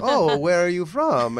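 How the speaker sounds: high, loud, drawn-out Italian clown voice; hammed-up comic Italian accent; stupid Italian accent. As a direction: slowly quiz a latecomer as a silly Italian. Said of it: "in this stupid Italian accent".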